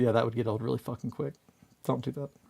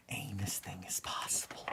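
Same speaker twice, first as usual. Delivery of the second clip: whisper; 4 semitones lower, 13 dB quieter than usual. Acted. Reading a sticker quote.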